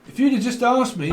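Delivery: ordinary talk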